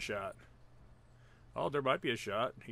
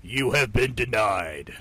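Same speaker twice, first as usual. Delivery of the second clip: low, slow game-announcer boom; 5 semitones lower, 3 dB louder, slower than usual. Pretend. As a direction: pronounce the verdict like an arena announcer. Mock game announcer call.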